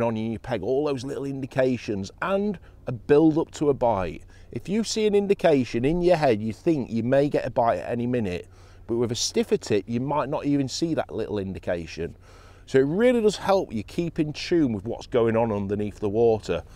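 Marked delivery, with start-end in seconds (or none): none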